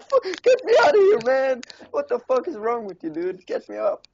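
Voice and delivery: panicked yelling. Retold or imitated. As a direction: scream for help, half crying. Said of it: "He's like".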